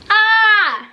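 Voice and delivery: sharp high exasperated "ah"; a burst of real annoyance at the mirror; frustrated cry. Real, not acted. Vlogger frustrated by her makeup, sharp exasperated cry.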